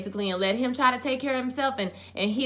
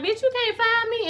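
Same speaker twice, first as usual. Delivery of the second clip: defiant retort; 14 semitones higher than usual. Retold or imitated. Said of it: "And Charity was like".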